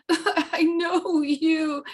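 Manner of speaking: high, slow mock wail with laughter; mock crying. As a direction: stretch it into a hammed-up sobbing cry. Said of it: "I started crying again".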